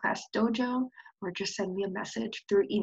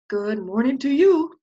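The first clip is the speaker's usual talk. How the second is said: loud, gruff bark of a hello; barked greeting; 2 semitones higher, 8 dB louder than usual. Acted. Prairie dog greets Mary with a loud bark. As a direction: bark it cheerfully. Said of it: "barked Jeffrey".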